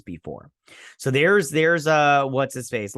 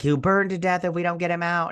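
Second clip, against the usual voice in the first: raised, higher urgent plea; urgent call; 7 semitones higher than usual. Retold or imitated. Son shouting to save his father from the fire, urgent raised call. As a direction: cry out to get the trapped father free.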